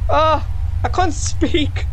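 laughing. Real, not acted. Laughing at a scare.

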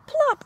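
high gasp then a bright plop; a sudden high plop; 1 plop sound. A narrator's reading. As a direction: pop the word out like a falling fig. Sound effect in picture book.

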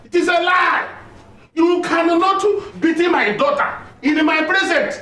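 high-pitched, indignant outburst; outraged protest. Acted. Father defends his daughter against a beating, outraged.

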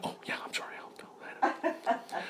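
tiny near-whispered sheepish reply; barely audible, embarrassed mumble; hushed apology. Retold or imitated. Acting his own sheepish apology.

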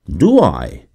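ordinary delivery

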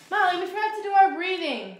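high, fast burst of remembering; sudden realization. Real, not acted.

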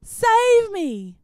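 high, loud cry for help; desperate yell. Acted. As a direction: scream it out while sinking. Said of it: "he suddenly yelled out".

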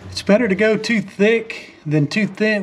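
normal talking voice

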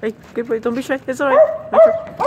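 coaxing a dog. Real, not acted. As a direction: call out to coax the dog closer. Coaxing a shy stray dog.

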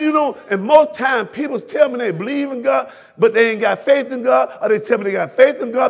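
normal talking voice

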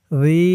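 রি as a soft th-like sound with long ee; tongue tip at upper teeth for রি, vowel held; soft zal. Shown as a demonstration. Teacher showing the soft zal, রি drawn out. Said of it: "জিহ্বার মাথা উপরের দাঁতের মাথার সাথে লাগবে".

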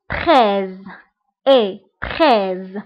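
تخيز said as French treize, clear e. Shown as a demonstration. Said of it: "كيتنطق بإي".

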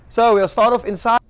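bright confident retake of "So we'll start"; upbeat host intro. Real, not acted. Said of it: "Okay, last time, let's do this".

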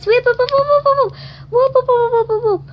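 very high, loud repeated whoops; high whooping cheer. Acted. Doll cheers the movie choice with high, loud whoops. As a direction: whoop like a siren of joy.

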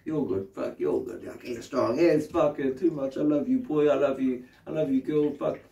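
hushed, tender reassurance; soothing. Acted. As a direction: soothe a child mid-hug, softly. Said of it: "they just need a bit of a hug".

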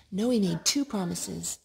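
ordinary delivery

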